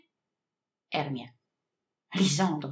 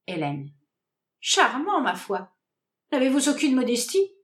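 plain reading voice

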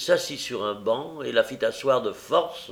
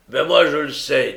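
loud, higher, irritated retort; 4 semitones higher, 9 dB louder than usual. A narrator's reading. Brigand answers stubborn Nancy, irritated. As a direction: cut back in with irritation. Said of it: "plus irrité de l'obstination de Nancy".

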